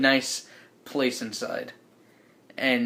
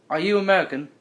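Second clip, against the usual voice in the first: higher-pitched put-on British accent; 5 semitones higher, 4 dB louder, faster than usual. British man questioning the players, put-on British accent. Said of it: "I tried to do it".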